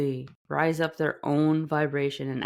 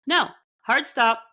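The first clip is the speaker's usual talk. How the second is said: firm hard stop; 9 semitones higher, 5 dB louder than usual. Real, not acted. Firm rejection after quote.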